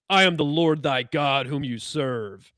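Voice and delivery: slow, distant, pretentious commanding voice; cold admonitory god voice. Retold or imitated. Voice in Bob's head claiming to be God, slow and cold. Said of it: "a cold and mo-, admonitory voice".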